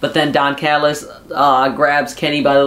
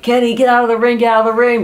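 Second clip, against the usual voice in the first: higher, hurried mock warning; imitated urgent warning; 6 semitones higher, faster than usual. Manager warning his wrestler in the ring, imitated urgency. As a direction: call him away urgently, lightly acted.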